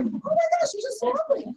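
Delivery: high, breathy gasp of fake innocence; a mock-innocent high gush. Shown as a demonstration. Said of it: "you said it so nicely".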